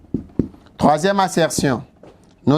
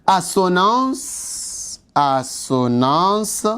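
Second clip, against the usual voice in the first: slow drill; much slower than usual. Shown as a demonstration. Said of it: "teacher drills the term".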